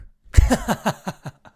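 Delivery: big burst of laughter; loud laugh. Real, not acted. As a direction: laugh out loud at your own bit. Laughs at own joke.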